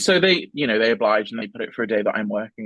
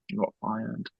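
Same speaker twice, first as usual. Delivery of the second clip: quiet deadpan; 9 dB quieter than usual. Retold or imitated. Deadpan reply to coworkers.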